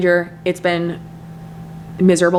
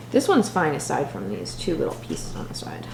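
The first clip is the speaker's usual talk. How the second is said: whispering; 4 semitones lower, 8 dB quieter than usual. Real, not acted. Quiet comment at night.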